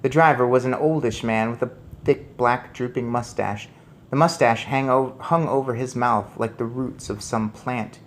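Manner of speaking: usual speaking voice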